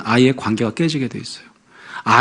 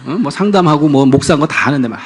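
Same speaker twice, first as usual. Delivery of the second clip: normal talking voice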